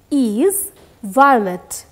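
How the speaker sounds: very slow, drawn-out words; slow model reading. Shown as a demonstration. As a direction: spell it out slowly for pupils. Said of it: "Again, repeat".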